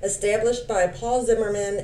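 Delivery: ordinary talk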